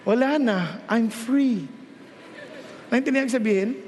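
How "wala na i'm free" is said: mock child answer. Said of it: "Kamusta ka na?".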